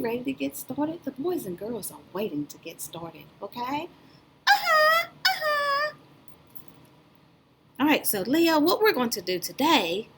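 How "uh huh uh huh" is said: a cheerful little puppet says hello; high cartoon puppet voice calling out; puppet Leo voice.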